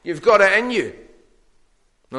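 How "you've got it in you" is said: higher, upbeat mock cheerleader; mock pep talk. Preacher mocking a pep talk Jesus never gave, cheerful and higher. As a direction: cheer them on like a motivational coach. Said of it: "Jesus doesn't say to them".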